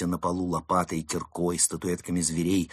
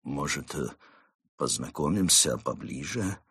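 low, softer, halting suggestion; nervous; 5 semitones lower, 4 dB quieter than usual. Acted. Nervous Mole asking to get acquainted, haltingly. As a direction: hesitate shyly between the words. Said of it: "предложил взволнованный Крот".

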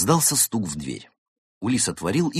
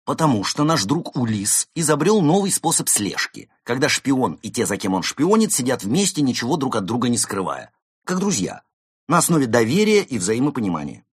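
high, loud mock-cheerful sarcasm; sarcastic; 7 semitones higher, 5 dB louder than usual. A narrator's reading. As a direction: lay the sarcasm on thick. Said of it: "максимум сарказма". (саркастично, с издёвкой)

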